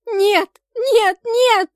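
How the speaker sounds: very loud, high repeated cries; desperate shriek; screaming till hoarse. A narrator's reading. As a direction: scream refusal with all your throat. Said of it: "снова кричу до хрипоты в горле".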